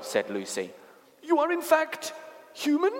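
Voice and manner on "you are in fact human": very high, wondering faun voice; astonished creature's careful question; amazed faun. A narrator's reading. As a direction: ask in high-pitched disbelief. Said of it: "said Lucy, still a little puzzled".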